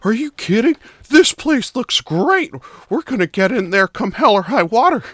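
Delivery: put-on high-pitched, eager kid voice; excited child. Child tells May they are getting into the carnival, excited kid voice. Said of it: "one of 'em looks at you".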